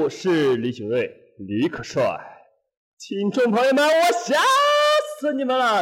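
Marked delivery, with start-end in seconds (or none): 3.0-5.8 high, loud playful yell; excited shout. Pretend. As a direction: shout it to jolt the audience. Host hams up scaring listeners.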